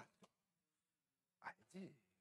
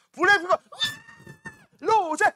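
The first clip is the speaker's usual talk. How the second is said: excited fangirl shout, higher and louder; fan screaming; 5 dB louder than usual. Retold or imitated. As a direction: squeal like a fan seeing idols. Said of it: "뭐 이런 식으로 하겠죠".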